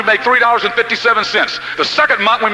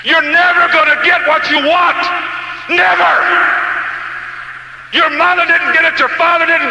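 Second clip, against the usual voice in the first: forceful preaching; 7 semitones higher, slower than usual. Real, not acted. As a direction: drive it home, then shout the last word. Preacher hammering a point.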